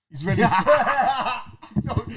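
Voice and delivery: high, fast words breaking into laughter; giggling through the line; laughing. Real, not acted. Fan laughing to his friend while watching the replay.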